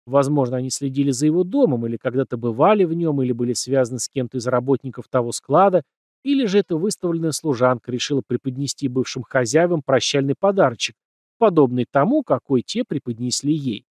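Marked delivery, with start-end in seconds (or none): none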